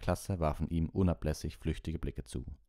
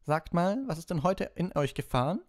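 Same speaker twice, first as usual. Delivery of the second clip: much higher, sharper teacher voice; a higher-pitched stern schoolmistress; stern professor; 14 semitones higher, 5 dB louder than usual. A narrator's reading. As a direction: scold the class as their professor. Narrator voices the professor scolding.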